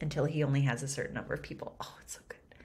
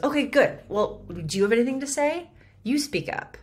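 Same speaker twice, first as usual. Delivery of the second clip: much higher, louder, fast snap; higher, louder demand; 8 semitones higher, 6 dB louder, faster than usual. Retold or imitated. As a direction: bark at him to speak up. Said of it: "He goes".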